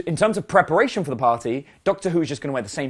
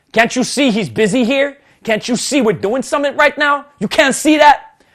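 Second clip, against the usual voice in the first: loud, raised mock tough-guy challenge; aggressive; 9 semitones higher, 8 dB louder than usual. Big guy confronting me at the mirror, aggressive. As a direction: imitate a meathead getting in someone's face. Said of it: "he just gets aggressive and in my face".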